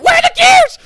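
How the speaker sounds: extremely high, loud shriek; panicked scream. Retold or imitated. Gamer screaming at friend during boss fight. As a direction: scream it at the top of your lungs. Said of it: "we just start shouting".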